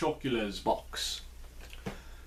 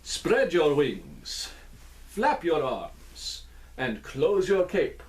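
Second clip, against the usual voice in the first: slow dance-step calling; slower than usual. Shown as a demonstration. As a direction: call each dance move slowly. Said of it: "one more time".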